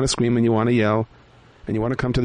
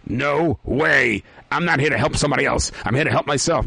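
grumpy character voice; 3 semitones higher than usual. Acted. Selfish character refusing.

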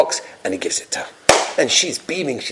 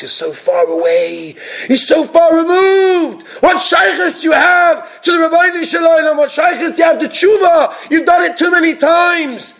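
taunting evil inclination; 12 semitones higher, slower than usual. Retold or imitated. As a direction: sneer at the sinner from far away. Voicing the Yetzer Hara taunting.

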